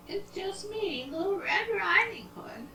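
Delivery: very high, soft falsetto; wolf posing sweetly as a little girl; funny disguised voice. Acted. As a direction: put on a tiny high voice. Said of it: "Why does your voice sound so funny".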